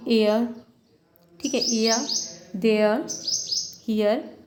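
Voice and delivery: "एयर", "देयर", "हियर" each said slowly and set apart; "एयर", "देयर", "हियर" enunciated so the glide to आ is heard; example words. Shown as a demonstration. Teacher models centring diphthong words, slow and clear. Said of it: "ये pronounce करेंगे तो जैसे इसको कैसे pronounce".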